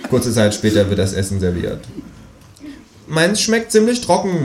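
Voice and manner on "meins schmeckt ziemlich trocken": high, whiny kangaroo grumble; grumbling kangaroo. Acted. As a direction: moan it high like a sulky cartoon animal. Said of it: "mault das Känguru".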